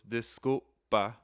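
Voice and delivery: slow, broken into syllables. Shown as a demonstration. Teacher counting out syllables for learners, syllable by syllable. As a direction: sound out "Disculpa" in slow, separate syllables. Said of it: "has a few syllables".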